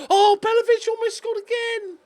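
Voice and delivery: thrilled high exclamation; excited near-goal cry. Real, not acted. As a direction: shout it as the shot almost goes in. Near goal in live play.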